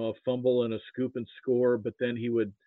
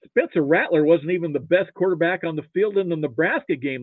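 emphatic retort; 5 semitones higher, 6 dB louder than usual. Retold or imitated. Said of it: "And I was like".

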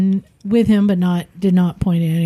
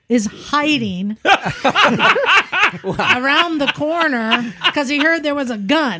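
laughing; 6 semitones higher than usual. Real, not acted. Laughing telling of John hiding.

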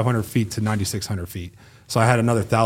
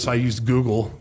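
usual speaking voice

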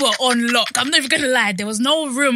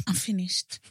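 voiceless, stifled laugh; whisper; 3 semitones lower, 5 dB quieter, slower than usual. Real, not acted. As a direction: whisper it, cracking up. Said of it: "whispered after joke".

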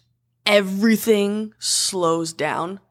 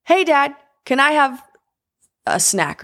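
put-on little girl asking dad; 6 semitones higher than usual. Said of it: "and says".

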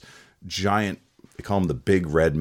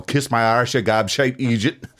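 put-on Irish-accented taunt flung back; cheeky Irish retort; Irish insult; 3 semitones higher, 4 dB louder than usual. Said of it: "like Scottish insults and Irish".

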